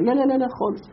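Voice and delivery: high mocking girl's no-no-no; preacher mimics a daughter refusing to help her mother. Mocked daughter refuses again.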